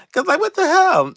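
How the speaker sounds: high, loud what the hell; squealed disbelief; exasperated outburst. Real, not acted. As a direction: throw the question up in disbelief.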